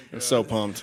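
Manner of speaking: ordinary delivery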